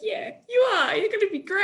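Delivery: high-pitched pep talk with a laugh; playful, bubbly reassurance; gushing encouragement. Acted. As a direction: cheer someone on, voice lifted right up. Acting out cheering on a losing contestant.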